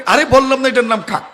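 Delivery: fed-up father losing patience; irritated father. Retold or imitated. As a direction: scold the child for asking again. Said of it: "আস্তে আস্তে আওয়াজ পরিবর্তন হচ্ছে".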